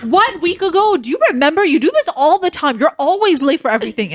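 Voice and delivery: hammed-up accusing girlfriend; mock nagging. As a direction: nag him like a girlfriend. Imitating bringing it up later.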